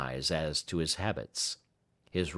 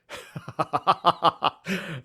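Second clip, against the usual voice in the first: big hearty laugh, high and loud; 10 semitones higher, 4 dB louder than usual. Acted. Baker laughing at his own goose's remains, hearty. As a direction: let out a full hearty laugh. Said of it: "burst into a hearty laugh".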